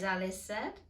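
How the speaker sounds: plain reading voice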